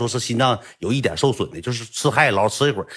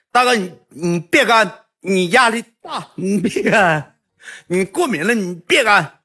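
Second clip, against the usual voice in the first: high, loud, drawn-out mock plea; pleading junior; 11 semitones higher, 5 dB louder, slower than usual. Junior begging big brother not to drink, pleading. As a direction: put on a slow, high pleading voice.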